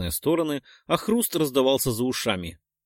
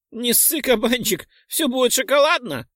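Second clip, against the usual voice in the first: high giggly pixie voice; giggling pixie; 11 semitones higher, 3 dB louder than usual. Acted. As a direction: giggle it carelessly. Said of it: "хихикнула Чопля". (хихикающая пикси)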